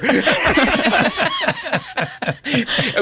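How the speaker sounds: rising laughter; long high laugh. Real, not acted. After telling how Jimmy got screwed, laughing long.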